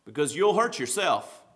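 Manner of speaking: usual speaking voice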